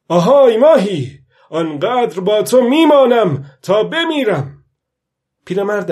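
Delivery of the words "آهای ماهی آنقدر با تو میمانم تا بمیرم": loud, high defiant call to the fish. A narrator's reading. Old fisherman vowing to the fish to stay till death, calling out loud. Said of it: "با صدای بلند گفت".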